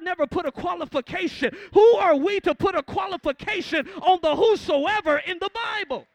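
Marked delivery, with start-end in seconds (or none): 1.7-6.1 loud, climbing preacher's challenge; preaching shout. Real, not acted. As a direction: hurl the question at the congregation.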